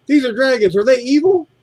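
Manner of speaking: high-pitched, alarmed customer question; imitated freak-out. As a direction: mimic her fretting, rising into a high question. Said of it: "she started freaking out".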